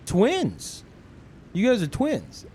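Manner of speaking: high-pitched mock astonishment; retold amazement, swooping high; amazed clerk. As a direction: imitate a clerk marveling at a discovery. Amazed clerk takes friends for twins.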